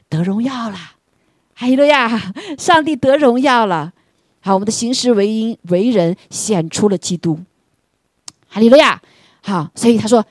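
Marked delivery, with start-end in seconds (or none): 0.1-9.0 exultant preaching. Acted. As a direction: lift the congregation in praise. (高声欢呼赞美)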